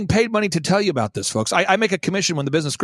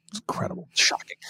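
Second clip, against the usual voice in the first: whispered in mock awe; whispered amazement; hushed aside; 7 dB quieter than usual. Real, not acted. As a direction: drop to a soft, confiding whisper. Awed aside about no distractions.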